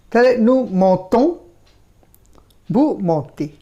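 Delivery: "montons" said slowly with a nasal "অ" sound at the end; "montez" ending in the "এ" sound, the z left silent; nasal vowel model. Shown as a demonstration. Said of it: "অ এর সাউন্ডটাই খালি আসবে". ("montons" শব্দে শেষে নাসিক্য অ উচ্চারণ, ধীরে)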